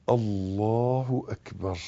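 soft, low, drawn-out chant; prayer takbir. Shown as a demonstration. Teacher showing the move into bowing, soft slow takbir. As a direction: intone it slowly and quietly as in prayer.

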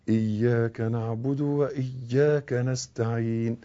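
soft, low, drawn-out إياك نعبد وإياك نستعين; slow correct recitation. Shown as a demonstration. Teacher showing the shadda, slow soft إياك with doubled y. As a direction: hold the doubled y in إياك, chanting softly. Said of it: "الفاتحة فيها أربعتاشر شدة".

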